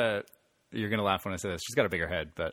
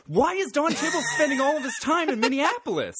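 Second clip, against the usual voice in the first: very high-pitched exasperated question; baffled outburst; 3 dB louder than usual. Real, not acted. Podcaster ranting over a plot hole, baffled outburst. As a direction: let bewilderment push the voice way up. Baffled rant about a movie scene.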